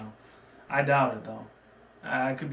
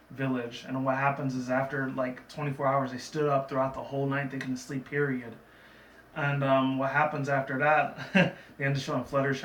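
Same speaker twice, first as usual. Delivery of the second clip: ordinary talk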